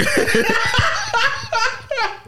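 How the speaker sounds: high screaming burst of laughter; shrieking laugh. Real, not acted. Friends crack up at the devil joke, shrieking laugh. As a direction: howl with laughter.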